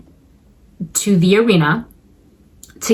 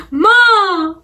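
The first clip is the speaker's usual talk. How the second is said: mock crying for mom; 11 semitones higher than usual. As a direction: cry it out like a spoiled boy. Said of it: "One thing goes wrong".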